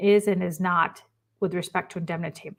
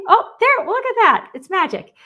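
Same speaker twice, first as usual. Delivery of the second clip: delighted surprise; 10 semitones higher, 5 dB louder than usual. Real, not acted. Delighted at something on screen.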